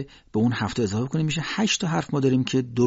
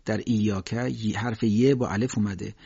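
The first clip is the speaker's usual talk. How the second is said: usual speaking voice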